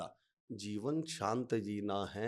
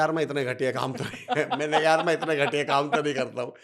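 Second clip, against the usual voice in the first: laughing while talking; 7 dB louder than usual. Real, not acted. Laughs through his own punchline.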